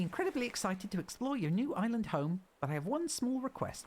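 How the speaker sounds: high-pitched helper voice; high, squeaky game character; chirpy game helper. Acted. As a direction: put on a high little voice for a polite request.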